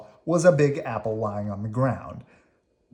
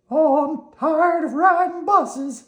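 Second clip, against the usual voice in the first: very high, slow, louder worm voice; Slimy weary; 7 dB louder, slower than usual. Acted. Slimy tells Oscar about the buses, in a very high weary voice. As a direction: moan about the trip.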